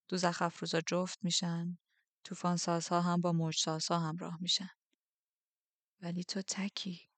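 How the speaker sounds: a hint of a soft, measured commander; understated quiet authority; lightly voiced Darkling.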